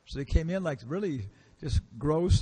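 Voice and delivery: usual speaking voice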